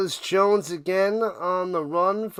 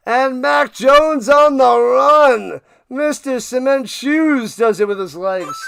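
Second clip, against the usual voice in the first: excited play call; 5 semitones higher, 7 dB louder than usual. Real, not acted. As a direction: call the run like a big moment.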